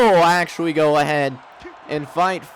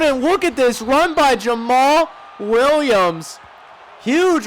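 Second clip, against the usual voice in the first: excited; 8 semitones higher, 3 dB louder than usual. Real, not acted. Big run by own team.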